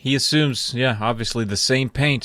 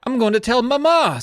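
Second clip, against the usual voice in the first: put-on astonished kid; 11 semitones higher than usual. Joe seeing the gnomes express, high-pitched mock astonishment. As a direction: act out a kid's awed discovery.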